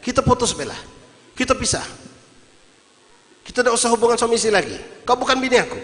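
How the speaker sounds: high-pitched heated declaration; angry break-up. Acted. Acted husband breaking off marriage.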